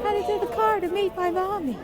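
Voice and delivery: high, bright-eyed sign-off; excited. Real, not acted.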